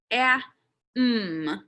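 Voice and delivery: "A, mm" as separate sounds, short a then held m; sounding out. Shown as a demonstration. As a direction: sound out "A, mm" slowly, one sound at a time. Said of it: "I can sound it out".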